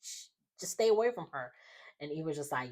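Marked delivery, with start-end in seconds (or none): 0.0-1.5 higher and a bit faster, pressing the point on away; insistent retold warning. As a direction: push the warning harder, higher in pitch. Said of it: "repeats the warning louder".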